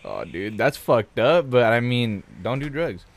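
usual speaking voice

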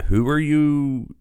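usual speaking voice

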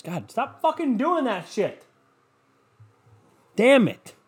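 high, slow exasperated snap; fed up with the barking again; annoyed at the dog. Real, not acted. Owner snapping at the barking dog again, high and exasperated.

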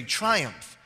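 ordinary talk, nothing marked

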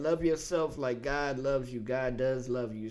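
ordinary talk, nothing marked

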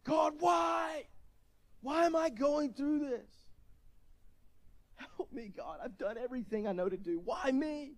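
kneeling at the chair, crying out to God in desperation; anguished yelling at God. Retold or imitated.

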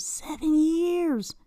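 high, drawn-out disbelief; incredulous. Real, not acted. Host reacting to seven years on Tinder, incredulous. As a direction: stretch it slowly, aghast at the figure.

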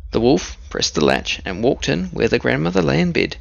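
plain reading voice

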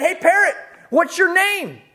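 calling out. Retold or imitated. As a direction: call out to the unseen voice. Man calls out in the dark.

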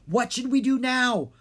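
yelling to friends over a storm; yelling over wind. A narrator's reading. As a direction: shout over the roaring winds. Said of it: "Drake yelled".

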